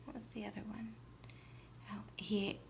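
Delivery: whispered aside. Real, not acted. Teacher trying to recall, whispered aside. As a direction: whisper the question to yourself. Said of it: "teacher whispers to herself".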